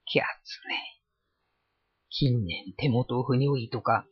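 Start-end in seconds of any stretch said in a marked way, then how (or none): 0.1-1.0 voiceless muttered aside. A narrator's reading. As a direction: mutter it under the breath. Kira mutters to himself.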